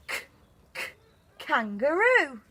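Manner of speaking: k, k, kangaroo with the k sound crisp and separate; phonics sound k. Shown as a demonstration. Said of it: "to write the kangaroo sound".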